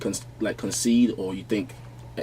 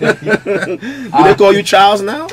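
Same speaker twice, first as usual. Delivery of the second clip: slow, high, louder mocking jab through laughter; teasing Nigerian brother; 11 semitones higher, 10 dB louder, much slower than usual. Mimicking Nigerian brothers teasing him about an English name, slow and laughing. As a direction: drag out the taunt while cracking up. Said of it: "start telling me".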